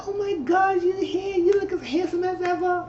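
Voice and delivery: very high, gushing mother voice; gushing Lady Mae. Retold or imitated. Lady Mae greeting Noah, very high gushing voice. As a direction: gush over him like a doting mom. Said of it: "She said".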